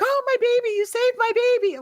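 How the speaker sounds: high, hammed-up grateful gush; gushing mother. Mother thanking the angel for saving her baby, high mock gush. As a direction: overdo the relieved mom, high and breathless. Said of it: "And she's like".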